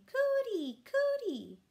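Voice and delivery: put-on very high, loud baby squeal. A narrator's reading. Baby in her high chair squealing at the table. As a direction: squeal it like a toddler banging a spoon. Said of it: "banged her spoon ... saying".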